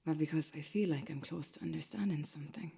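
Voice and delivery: a hushed whisper, half ashamed; whispered confession. Acted. Alone at his computer, admitting the fascination in a whisper. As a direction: breathe it out as a secret.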